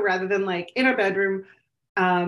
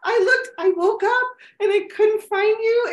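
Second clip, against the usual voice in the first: put-on child voice; 9 semitones higher than usual. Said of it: "she's like".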